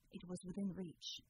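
ordinary delivery

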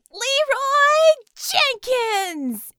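long drawn-out high yell; battle cry. Acted. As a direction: stretch the cry out loud.

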